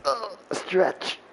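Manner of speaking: normal talking voice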